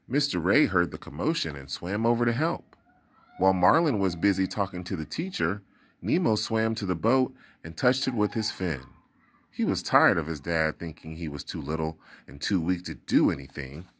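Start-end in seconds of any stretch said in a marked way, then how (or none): none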